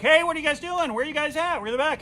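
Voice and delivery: loud, high party hollering; rowdy friends calling. Retold or imitated. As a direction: barge in calling out.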